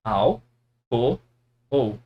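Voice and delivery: Al, co, ou separated with pauses; syllable by syllable. Shown as a demonstration. Teacher to students, separating álcool syllable by syllable. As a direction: break it up as Al, co, ou. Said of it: "nós não falamos dessa forma".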